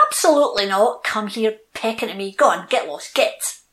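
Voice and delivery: loud brusque shooing off; cross dismissal. Acted. Chestnut tree chasing off the robin, a loud cross shoo. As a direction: send the pest packing.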